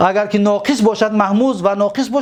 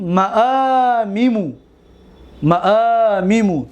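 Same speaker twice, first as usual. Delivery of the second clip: long, stretched vowels; slow recitation; slower than usual. Shown as a demonstration. Teacher to students, slow stretched form. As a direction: draw the word out for the class.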